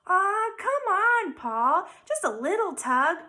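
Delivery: slow, high, coaxing little voice; high wheedling pigtail voice; urging pigtail. A narrator's reading. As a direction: wheedle him into it. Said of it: "urged the pigtail".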